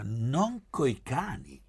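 high, drawn-out protest; mild cry. A narrator's reading. Gabriella objecting to hunting with dogs, mild cry. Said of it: "gridò Gabriella".

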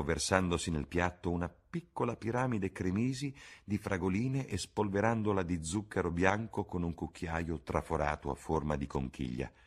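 plain reading voice

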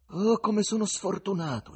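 high, loud lament; dismayed exclamation. A narrator's reading. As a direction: cry out at your bad luck. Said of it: "esclamò, ma si riprese subito".

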